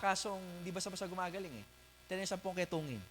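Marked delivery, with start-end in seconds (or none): none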